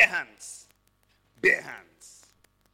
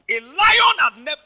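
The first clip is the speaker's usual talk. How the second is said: 1 excited preaching burst; 6 semitones higher, 10 dB louder than usual. Real, not acted. Preacher builds to climax.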